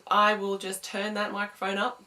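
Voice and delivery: ordinary talk, nothing marked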